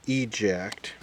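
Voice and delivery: normal talking voice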